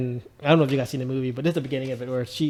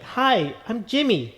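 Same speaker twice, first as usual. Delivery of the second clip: put-on higher, boyish sheltered voice; Jimmy's voice; 7 semitones higher, slower than usual. Retelling the film, he mimics Jimmy's higher voice. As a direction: play the sheltered bubble boy introducing himself. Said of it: "like, his voice".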